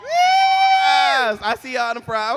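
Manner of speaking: high, loud drawn-out yes; shouting to crowd. Real, not acted. Guest calling out to fans in the front. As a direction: call it out to the front rows.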